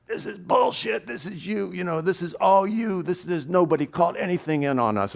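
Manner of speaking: high-pitched angry rant at the cop; going off. Retold or imitated. Swank going off on the sergeant, high indignant rant. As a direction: retell him blowing up, voice jumping high. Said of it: "he just went off on this guy".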